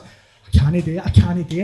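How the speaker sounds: beaten, on the edge of tears; nearly crying. Acted. Stracky balking at crossing the motorway, mildly tearful. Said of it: "he's nearly crying".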